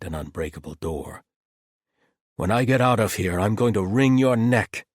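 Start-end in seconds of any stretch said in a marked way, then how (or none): 2.4-4.9 loud, menacing threat; angry threat. Acted. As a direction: promise revenge. Galen threatens captor.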